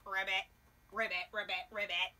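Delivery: low frog croaks. Acted. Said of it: "said Frog".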